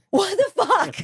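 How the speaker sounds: can't believe what she's hearing; incredulous. Retold or imitated. Retelling the surgery center's call, a high disbelieving what-the-fuck.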